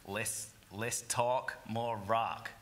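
punchy American slogan delivery; American accent. Retold or imitated. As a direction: say it like a brash American. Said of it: "This only works in an American accent".

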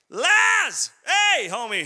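high, loud friendly shout; loud call. Acted. Jesus hollering for Lazarus at the tomb, loud. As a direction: holler for your buddy.

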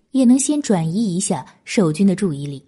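usual speaking voice

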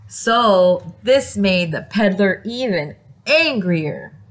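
plain reading voice

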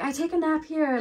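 high-pitched put-on American kid voice; mock American accent. Reader imitating a crying kid from a beach meme, mock American accent. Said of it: "that's my American accent".

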